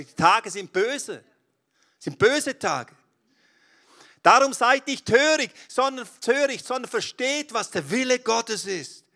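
loud, high, fervent preaching; preaching at full force. Acted. Preacher thunders Paul's call to the congregation, fervent. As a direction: proclaim it to the whole hall.

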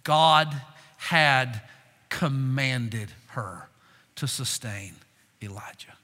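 ordinary talk

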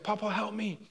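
high-pitched, softened mock plea; retold cry for help. Preacher retelling a grandson's plea under attack, mock yell. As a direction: imitate a grandson calling out to grandpa. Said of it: "he's yelling out".

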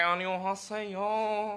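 very drawn-out, high Korean hello; Korean greeting. Acted. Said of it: "we can be, like, Korean".

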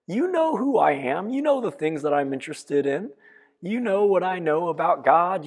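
put-on a sassy boy talking back to his mother; mildly mocking smart-aleck kid; smart response. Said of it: "pipes up with a little bit of a smart response".

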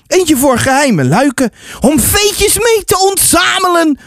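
put-on high, eager child's protest; insistent boy. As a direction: argue back like a keen boy. Boy explains the secret key.

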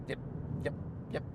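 put-on quick, high, breathy Indian-accented yep yep yep; imitating a fast Indian accent. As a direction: rattle it off like a fast-talking Indian colleague. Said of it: "talks like this".

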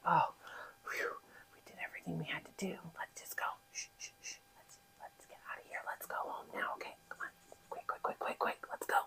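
hushed and breathless, in a hurry to sneak off; urgent whisper, rushing the quicks. Acted. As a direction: whisper like you're about to get caught. Brothers sneaking away, hushed.

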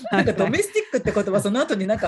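amused, laughing as you talk; 笑いながら. Real, not acted. Laughing at the word's association. (笑いをこらえきれずに)

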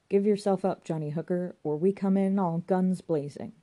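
bawled order. Acted. Police outside order a wanted man out, bawling. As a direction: bawl it as if through a loudhailer. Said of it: "start bawling through a loud hailer".

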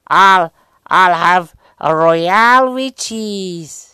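loud, slow, high American accent; hammed-up American movie-star impression, stretched out; Sam Jackson. Shown as a demonstration. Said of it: "Sam Jackson".

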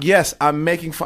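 emphatic comeback. Retold or imitated. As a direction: stretch it out, exasperated with the heckler. Comic fires back at heckler.

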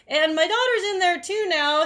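high, loud exasperated outburst; high, loud, disbelieving cry. Retold or imitated. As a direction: throw up your hands in disbelief. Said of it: "I was just like".

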